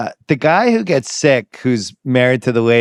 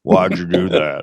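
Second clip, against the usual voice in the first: Ted Levine; 6 semitones lower, slower than usual. Retold or imitated. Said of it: "Ted Levine was furious".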